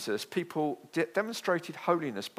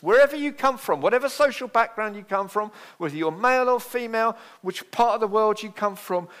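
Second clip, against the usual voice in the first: much higher and louder, ringing preacher build; preaching at full force; 7 semitones higher, 5 dB louder than usual. Acted. Preacher rallying the whole congregation, rousing and loud. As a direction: lift it to a rousing climax.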